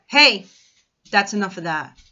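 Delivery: sharp scold to the dog. Real, not acted. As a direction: snap at the dog to stop.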